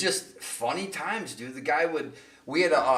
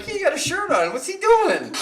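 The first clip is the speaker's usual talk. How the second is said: baffled; 6 dB louder than usual. Retold or imitated. Baffled by what he sees.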